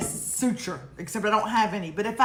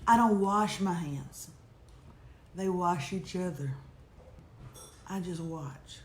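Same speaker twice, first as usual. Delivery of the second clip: low, hushed and slow, with long pauses; an Oprah moment, softly intoned and dropping away; dramatic emphasis; 6 semitones lower, 6 dB quieter, slower than usual. Shown as a demonstration. Repeating the emailed line to the audience, hushed dramatic emphasis. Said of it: "with drama, dramatic emphasis".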